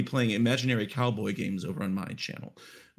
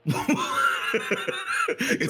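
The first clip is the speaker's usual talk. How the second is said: laughing; 6 semitones higher, 3 dB louder, much slower than usual. Real, not acted. Joke with laughter.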